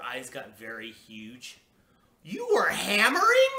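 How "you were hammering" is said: very high, loud incredulous yell; mother's yell. A narrator's reading. Mother yells at her daughter about hammering. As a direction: yell it in disbelief. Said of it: "she yelled".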